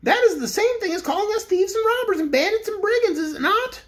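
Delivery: high indignant complaint. A narrator's reading. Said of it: "continued Corporal Waddle indignantly".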